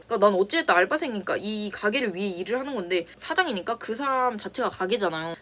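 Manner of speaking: normal talking voice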